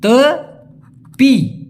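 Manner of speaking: তেপি stretched very slowly, syllable by syllable; drawn-out demo. Shown as a demonstration. Teacher stretches a Malay word so students catch it. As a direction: pull the word তেপি out long. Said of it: "সেই ক্ষেত্রে হবে".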